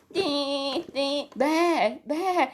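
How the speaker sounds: a high, crying baa from a frightened little lamb; lamb bleating. Acted. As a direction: bleat like a lost lamb calling for help. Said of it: "he's bleating".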